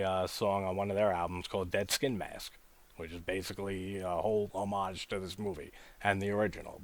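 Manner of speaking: ordinary talk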